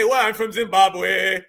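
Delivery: slow, drawn-out Zimbabwean caller voice; exaggerated put-on Zimbabwean accent; mock Zimbabwean accent. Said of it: "When they say".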